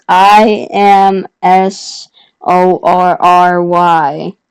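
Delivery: very slow, letter by letter; drawn-out retold spelling for someone slow to understand. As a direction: spell it pointedly, each letter separate. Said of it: "spell it out for you".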